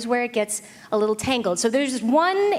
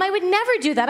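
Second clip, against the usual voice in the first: put-on "never" in a pitched-up, wide-eyed kid voice; mock innocent denial; 7 semitones higher than usual. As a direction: swear you'd "never", all innocence. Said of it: "I was like".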